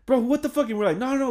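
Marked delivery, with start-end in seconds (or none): 0.0-0.7 angry outburst. Retold or imitated. Alex yelling at friends over egg in his car, outraged. 1.0-1.3 denial. Retold or imitated.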